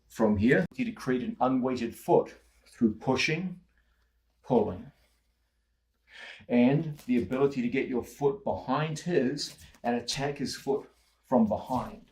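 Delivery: normal talking voice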